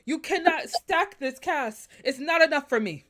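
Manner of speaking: indignant, comically worked-up complaint; retold pissed-off rant. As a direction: rant as if telling off the cast, hammering each refusal. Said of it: "how pissed I was".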